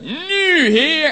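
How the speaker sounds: high, loud mock wail; hammed-up insistent cry; impatient demand. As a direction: stretch it out like a demanding child. Acting out impatient demand to God.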